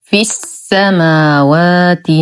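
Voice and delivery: slow في السماوات, short i into doubled س; joined recitation. Shown as a demonstration. Teacher reciting the join, slow في السماوات with held sin. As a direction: chant في السماوات as "fis-samaawaati". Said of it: "فحرف المد لا يثبت".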